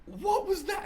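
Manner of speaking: comic bafflement to the crowd; baffled. Retold or imitated. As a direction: act thrown by the crowd.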